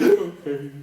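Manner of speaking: small mock-tearful answer; blubbering mess. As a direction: give in like someone who has been crying. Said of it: "I was just a blubbering mess".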